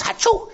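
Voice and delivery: sudden high-pitched sneeze; hammed-up hay fever sneeze; mock sneeze. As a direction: burst out with a sharp fake sneeze. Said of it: "might just sneeze".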